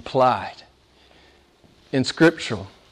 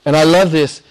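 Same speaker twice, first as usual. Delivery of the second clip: ordinary talk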